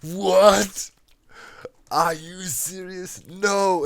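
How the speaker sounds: stunned, the delivery hopes dashed; shocked disbelief. Retold or imitated. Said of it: "I was like".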